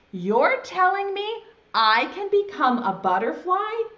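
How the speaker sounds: high, incredulous question; amazed caterpillar. Acted. Caterpillar learns he can become a butterfly, amazed. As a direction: marvel at the news, voice climbing.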